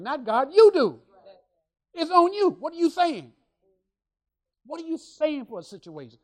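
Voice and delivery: emphatic, high-pitched challenge; forceful exhortation. Real, not acted. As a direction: press the congregation hard. Preacher pressing the congregation to speak.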